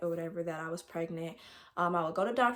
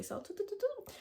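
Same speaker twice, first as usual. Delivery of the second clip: high sung do do do, softer; rewind sound effect; 14 semitones higher, 6 dB quieter than usual. Pretend. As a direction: hum a tape rewinding, way higher. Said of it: "I have to rewind actually".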